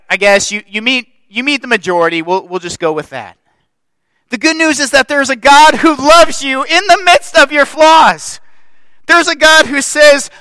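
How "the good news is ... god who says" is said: loud, high preaching build. Acted. Pastor preaching loud to his church. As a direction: preach it out to the congregation.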